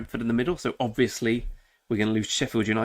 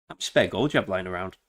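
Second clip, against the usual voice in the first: ordinary talk, nothing marked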